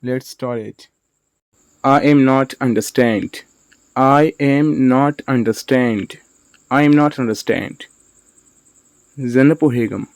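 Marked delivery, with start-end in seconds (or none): 3.9-6.2 slow, separated words for learners; slow model sentence. Shown as a demonstration. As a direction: say "I am not understand" slowly, word by word. Teacher repeats word by word.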